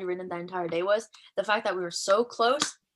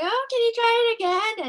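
high, put-on pleading voice; sweet pleading; 5 dB louder than usual. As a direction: beg the scanner innocently. Pleading with ticket scanner.

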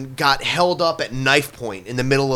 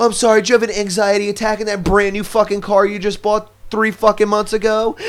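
loud, higher hammed-up sneer; hammed-up sarcastic pity; sarcastic mock pity; 7 semitones higher, 4 dB louder than usual. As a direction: sneer at the spoiled complainer, loud and high.

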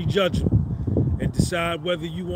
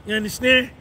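normal talking voice

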